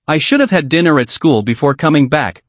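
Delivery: usual speaking voice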